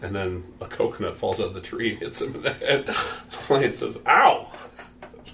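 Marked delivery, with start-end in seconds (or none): 4.1-4.5 loud, high "ow"; sudden sharp pained cry; acted yelp of pain. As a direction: yelp as if hit on the head. Coconut hits lion on the head.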